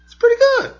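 put-on very high little boy voice; kid voice. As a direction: squeak it out like a small kid. Dad voicing his young son.

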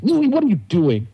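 mildly hammed-up grandma telling-off; grandma's mock yelling. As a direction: scold him like grandma, without raising volume. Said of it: "gonna yell at him too".

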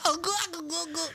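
high, goofy nonsense in his voice; silly high caricature without real words; mock gibberish impression. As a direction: mimic him as cartoon gobbling. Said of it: "I think I just don't listen to your voice".

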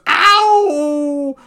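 long, loud, high yelp; cartoon howl. Imitating character's eye-popping howl.

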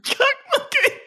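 high, strained band-name yell; mock punk scream. Announcing an imaginary punk band, screamed high.

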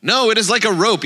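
high, insistent objection; mild storybook yell. A narrator's reading. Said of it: "yelled the sixth blind man".

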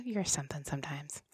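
whispered. Real, not acted. Playful scolding aside.

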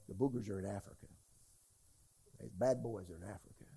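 low, soft, fast mock-spooky voice; spooky warning. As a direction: imitate the scared warners, deep and hushed. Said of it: "everybody told me".

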